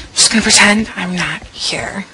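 hushed hiding whisper. Acted. Hiding from the date.

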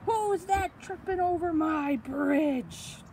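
loud, high, drawn-out troll shout; troll roaring a challenge; mean troll. Acted. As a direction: bellow slowly as a menacing troll. Troll challenges biggest goat.

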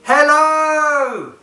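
loud, high called hello; shouted hello. Shown as a demonstration. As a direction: call it out big. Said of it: "Can you shout".

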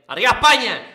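loud, proud cheer for Spain; patriotic cheer. Real, not acted. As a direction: shout it like a rallying cry.

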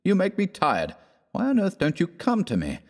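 high, exasperated scolding. Acted. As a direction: scold him in frustration. Trent scolds his poor friend.

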